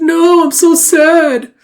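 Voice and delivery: high, loud, very slow wail; badly overdone sobless lament; mock wailing grief. Sister mourning her brother, mock wailing.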